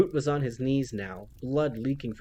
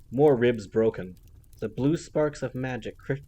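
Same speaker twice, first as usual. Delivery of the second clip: ordinary delivery, nothing marked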